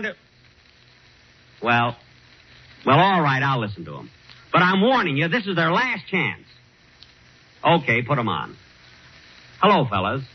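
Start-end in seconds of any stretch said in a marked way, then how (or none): none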